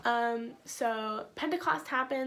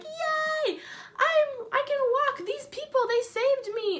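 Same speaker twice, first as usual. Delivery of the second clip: much higher, squealing joy; overjoyed healed man; 12 semitones higher, 4 dB louder, slower than usual. Acted. Healed man jumping around, squealing high with joy. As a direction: bounce with delight. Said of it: "He's like".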